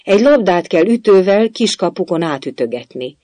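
high, loud explanation; calling out. A narrator's reading. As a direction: shout the answer back confidently. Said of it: "kiáltotta Alice".

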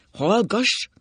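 high, loud angry cut-in; bellowed command. A narrator's reading. Drunk husband cutting off his wife, bellowing. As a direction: roar her into silence. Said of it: "rivolt rá az öreg".

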